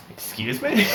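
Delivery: high-pitched, puzzled query; baffled. Retold or imitated. Acts out his baffled reply.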